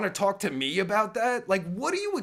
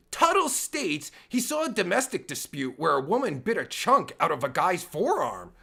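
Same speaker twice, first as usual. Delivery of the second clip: ordinary talk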